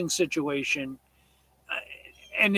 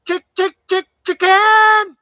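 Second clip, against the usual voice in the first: calling chickens; 13 semitones higher, 10 dB louder than usual. Real, not acted.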